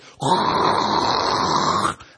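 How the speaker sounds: long, loud imitated gas roar; roaring burner noise. Potter showing four burners near the end, loud roaring hiss. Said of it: "burners are going like this".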